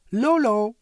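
high, loud call across. A narrator's reading. Floor calling to the imp on another cloud, loud call. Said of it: "riep ze naar de imp".

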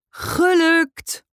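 very high, drawn-out cheer; triumphant cry. A narrator's reading. Jackie landing her jump onto the table, triumphant cry.

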